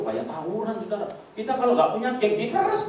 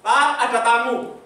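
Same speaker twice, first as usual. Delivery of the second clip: raised voice calling to a husband; lightly voiced mother; 6 semitones higher, 5 dB louder than usual. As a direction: call out as Mom announcing guests. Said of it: "ada suara dari mami saya". (tiruan ringan ibu memanggil)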